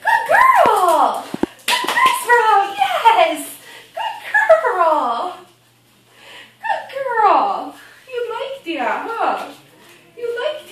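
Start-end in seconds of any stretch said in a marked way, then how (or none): 0.0-7.7 squeaky praising a dog. Real, not acted. Praising puppy.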